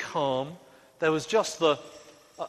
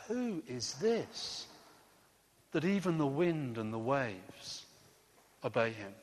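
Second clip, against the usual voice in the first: awed hush; 6 dB quieter than usual. Acted. Frightened disciples asking each other, in an awed hush. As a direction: breathe it out in amazement.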